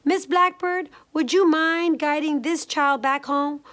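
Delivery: high, loud mother bear call. A narrator's reading. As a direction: call up to someone overhead. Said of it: "Mama Honey Bear called to Miss Blackbird".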